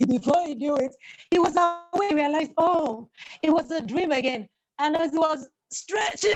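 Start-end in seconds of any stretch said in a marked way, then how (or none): none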